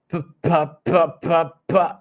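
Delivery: sílabas de bebé, más agudas y fuertes; balbuceo de bebé. Shown as a demonstration. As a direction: imita a un bebé que balbucea "pa, pa". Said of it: "eso es lo que se llama balbuceo". (balbuceo de bebé agudo y fuerte)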